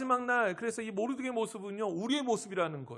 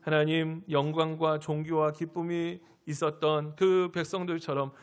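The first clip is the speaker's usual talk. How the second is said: earnest prayer, slightly louder and lower; public prayer; 3 semitones lower, 4 dB louder than usual. Real, not acted. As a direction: lead the congregation in fervent prayer. Leads closing prayer. (힘 있게 간구하는 대표 기도)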